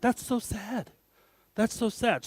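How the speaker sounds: lightly voiced pitying onlooker; pitying stare. People in India staring, hushed pity. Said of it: "this is the look I get".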